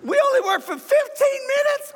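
can't believe the pay is so generous; amazed disbelief. Retold or imitated. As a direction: workers stunned at the pay, squealing in surprise. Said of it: "they probably said".

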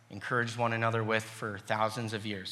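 usual speaking voice

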